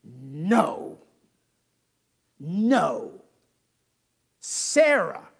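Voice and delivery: firm separate No, No, Sarah; emphatic God. Acted. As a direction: put God's foot down on each word. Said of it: "God answers him somewhat emphatically".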